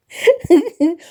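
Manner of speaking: high, loud burst of laughter. Real, not acted. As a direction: crack up at the thought. Laughing about forced accents.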